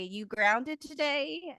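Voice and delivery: joking tease. Retold or imitated. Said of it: "they jokingly do it".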